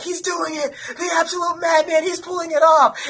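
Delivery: high-pitched retold cheer of disbelief; imitating thrilled onlookers screaming; excited screaming. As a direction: cheer on a madman, voice way up. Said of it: "screaming alongside Elsie".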